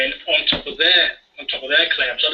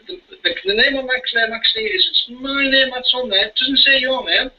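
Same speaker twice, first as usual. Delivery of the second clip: raised, slowed indignant voice; mock indignant; 8 semitones higher than usual. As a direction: pitch up and press the point. Acting out confronting clerk.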